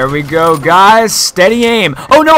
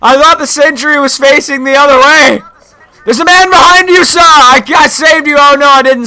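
high-pitched gamer outcry; exasperated, excited shouting; worked-up yelling; 6 semitones higher, 4 dB louder than usual. Real, not acted. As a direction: yell it in a raised, high voice. Yelling in panic during game.

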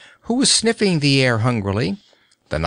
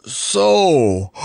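yawning lion; 3 semitones higher than usual. A narrator's reading. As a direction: yawn right through the word. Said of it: "yawned the Cowardly Lion".

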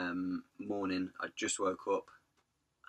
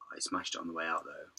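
usual speaking voice

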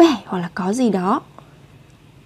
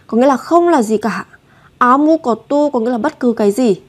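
ordinary talk, nothing marked

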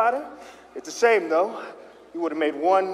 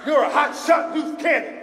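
hyped-up cop; 9 semitones higher than usual. Acted. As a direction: pump him up like a cop captain. Cop boss hypes Harry.

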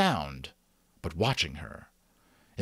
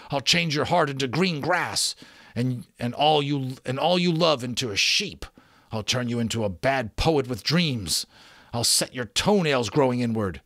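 raised, higher-pitched storybook tirade; indignant cursing; 5 semitones higher, 4 dB louder than usual. A narrator's reading. Magician pinning Rook down and cursing him, acted indignant tirade. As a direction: hurl each curse with rising indignation.

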